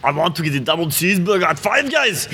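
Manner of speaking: thick Austrian bodybuilder accent; booming action hero growl; Arnold voice. Shown as a demonstration. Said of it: "say, uh, in the Arnold voice".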